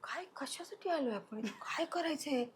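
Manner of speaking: soft, unvoiced, lost whisper; dazed whisper. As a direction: whisper it as if your mind has gone blank. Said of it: "मी literally blank होते". (मन रिकामं झाल्यासारखी हरवलेली कुजबुज)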